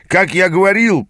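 high, pointed resumption; a touch of showy insistence; insistent mentor. A narrator's reading. Mentor resumes his interrupted tale.

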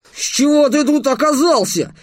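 high-pitched, groaning complaint; moaning dismay. A narrator's reading. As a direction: moan it in exasperated dismay. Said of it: "простонал Дерек".

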